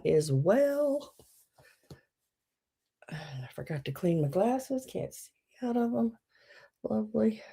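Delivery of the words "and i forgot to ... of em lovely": under the breath, not for the audience; quiet muttered aside; whispered aside. Real, not acted. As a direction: whisper it to yourself. Muttering to self off mic.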